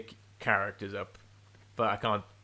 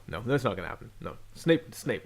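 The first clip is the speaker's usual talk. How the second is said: normal talking voice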